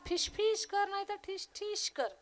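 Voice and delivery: high, soft mocking voice; defiant cat. Tomcat mocking helpless husband, high taunting. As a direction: mimic his shooing tauntingly. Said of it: "बोका म्हटला".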